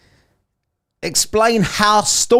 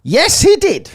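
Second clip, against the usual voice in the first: excited; 8 dB louder than usual. Real, not acted. Host exclaims loudly.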